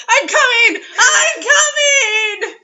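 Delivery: high loud drawn-out mock scream; hammed-up orgasm scream. Reader hamming up a screaming climax for laughs.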